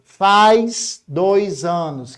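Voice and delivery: "faz dois anos" dragged out word by word; drawn-out teaching example; very slow. Shown as a demonstration. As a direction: spell out the example very slowly. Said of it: "o verbo fazer fica no singular".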